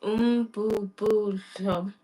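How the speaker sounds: "Umbubudlo" very slow, one syllable at a time; careful repetition. Shown as a demonstration. Learner copying the teacher's correction, very slow careful repetition. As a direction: sound out "Umbubudlo" slowly, bu without a final m. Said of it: "Hundred percent".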